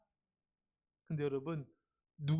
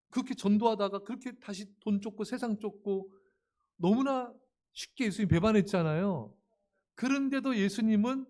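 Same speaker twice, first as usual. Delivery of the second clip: ordinary talk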